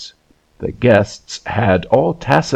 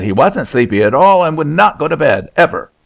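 put-on higher-pitched childish protest, not truly screamed; mild mock scream; 6 semitones higher than usual. A narrator's reading. Sleepy little boy refusing bed, mildly screamed protest. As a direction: protest like an overtired, stubborn child. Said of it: "on which he screamed out".

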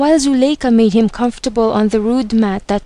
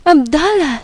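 higher-pitched narrated call; a woman's cry, acted by a storyteller; startled cry; 6 semitones higher than usual. A narrator's reading. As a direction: cry out the name, voice lifted. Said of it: "she cried".

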